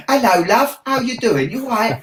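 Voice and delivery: high chirpy Southampton accent greeting. Shown as a demonstration. Showing the interviewer his Southampton accent, high and chirpy. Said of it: "I might have to practice".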